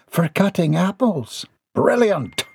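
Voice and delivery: high, pleased character voice; high-pitched delight; delighted woman. Acted. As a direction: exclaim high with glee.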